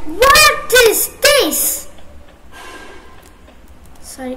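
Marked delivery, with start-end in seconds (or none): 0.1-1.9 drawn-out loud what is this; slow angry outburst. Real, not acted. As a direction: stretch it out, mad and loud. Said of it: "I'm gonna be mad".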